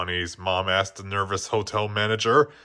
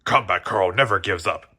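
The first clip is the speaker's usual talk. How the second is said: a lower, gruff soldier motto; soldier toy voice; 4 semitones lower than usual. A narrator's reading. Toy soldier boasting his motto to Jessie.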